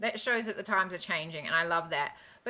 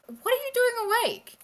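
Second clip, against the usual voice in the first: high-pitched surprised question, retold; puzzled, pitched well up; 13 semitones higher, 4 dB louder than usual. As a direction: imitate a colleague caught by surprise. Chief mate's puzzled question, retold.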